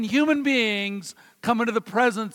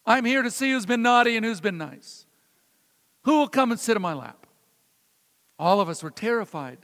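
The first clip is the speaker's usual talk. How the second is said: ordinary delivery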